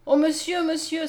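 high, urgent exclamation; crying out. A narrator's reading. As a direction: call out in sudden hope. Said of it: "s'écria Mariette". (s'écrier, voix haute et émue)

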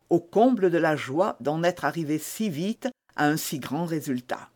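ordinary delivery, nothing marked

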